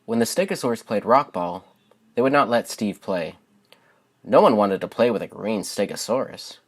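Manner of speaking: gentle, expressive storybook narration; lilting read-aloud voice for young listeners; reading to children. Acted. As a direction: read it like a bedtime picture book for kids. Reads picture book aloud.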